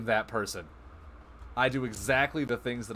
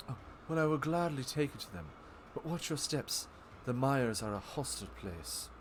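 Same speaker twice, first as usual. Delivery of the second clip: softer, lightly voiced gracious ally; Tenebra voice; 8 dB quieter than usual. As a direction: offer help, then warn gently. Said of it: "clearly that was Tenebra talking".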